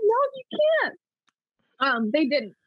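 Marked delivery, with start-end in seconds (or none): 0.0-1.0 high-pitched mock protest; exasperated veto in a raised voice; imitated dad's objection. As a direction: retell a scandalized refusal, pitched way up.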